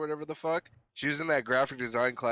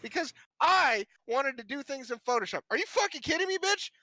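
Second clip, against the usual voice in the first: mock rant; 14 semitones higher than usual. Student ranting at the graphic design teacher, high angry yell. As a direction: rage at her like a wronged student.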